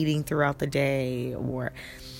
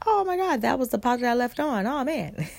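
startled realization; 7 semitones higher than usual. Retold or imitated. Realizing the pot was left on.

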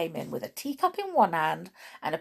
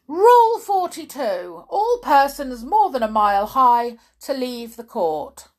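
loud, high royal pronouncement; official reading out; 6 semitones higher, 10 dB louder than usual. A narrator's reading. King reading a rule out to the court, loud proclamation. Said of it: "the king read out from a book".

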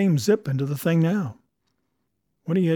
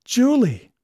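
astonished cry; 10 semitones higher, 8 dB louder than usual. A narrator's reading. Danby recognises old sweetheart.

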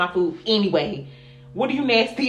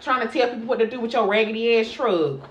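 normal talking voice

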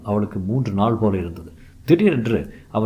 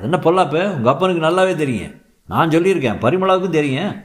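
irritated retort; 7 semitones higher, 3 dB louder than usual. Acted. Husband defensive quarrel.

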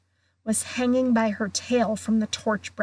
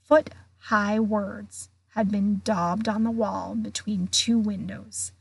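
ordinary delivery, nothing marked

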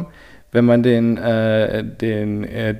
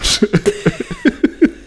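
high squealing laugh. Real, not acted. Embarrassed laugh after ASMR bit.

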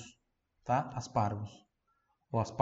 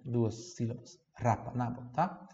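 ordinary talk, nothing marked

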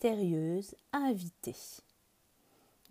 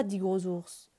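ordinary delivery

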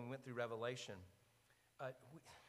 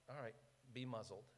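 softer, unhurried authority; calm quiet command; 2 semitones lower, 10 dB quieter than usual. Retold or imitated. Jesus stands up and simply hushes the storm, softly. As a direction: settle it with a soft word.

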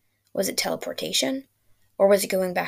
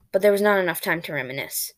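plain reading voice

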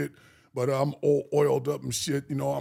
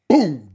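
a sudden, loud shouted boom; loud door-kick boom; 12 dB louder than usual. Retold or imitated. Stripper mimicking the police kicking the door in, a loud boom. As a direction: slam the sound out like a door kicked in.

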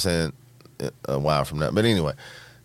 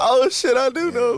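high-pitched mock realization; imitated recognition; 12 semitones higher, slower than usual. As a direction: act out sudden recognition, voice pitched way up. Acted quote of character's recognition.

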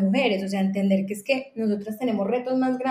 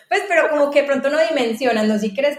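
laughing through the words, a bit louder; 5 semitones higher, 6 dB louder than usual. Real, not acted. To the host who believes her, laughing and louder. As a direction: let the laugh spill into the protest.

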